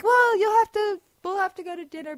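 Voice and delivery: high, loud burst on the first words, then easing; loud retold reply. Actor brushing past her embarrassing remark, loud and high. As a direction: imitate a booming phone reply.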